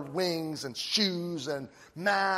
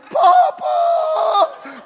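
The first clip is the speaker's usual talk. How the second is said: very high, loud, drawn out like slow motion with a laugh behind it; drawn-out terrified cry; 12 dB louder than usual. Retold or imitated. Boy let go in water, crying out to grandpa in slow motion. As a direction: wail his name in slow motion.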